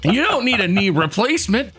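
hammed-up quack doctor sales pitch; Doctor Shiny Nog. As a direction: sell it like an infomercial doctor, stretching key words.

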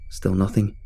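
ordinary delivery, nothing marked

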